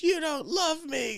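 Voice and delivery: high, slow mock-tearful wail; imitated crying girl. Imitating crying sister confronting dad, tearful wail. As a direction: wail it like a hurt little girl. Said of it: "and then she's crying".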